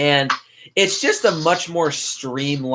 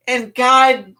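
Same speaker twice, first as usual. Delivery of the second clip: exasperated; 9 semitones higher, 6 dB louder, much slower than usual. Real, not acted. Exasperated aside about bad dubbing.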